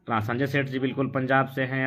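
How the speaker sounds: ordinary talk, nothing marked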